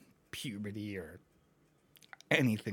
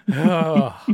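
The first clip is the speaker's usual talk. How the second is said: usual speaking voice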